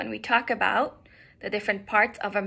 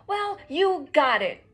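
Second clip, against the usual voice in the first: loud, higher-pitched huffy retort; 5 semitones higher, 6 dB louder than usual. A narrator's reading. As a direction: answer back in a mild huff. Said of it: "Duck huffed".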